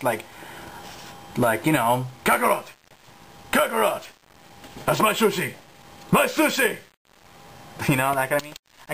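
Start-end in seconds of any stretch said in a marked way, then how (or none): 2.2-6.8 attempted deep Vegeta shout; a kid straining for Vegeta's low growl. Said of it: "the deep voice".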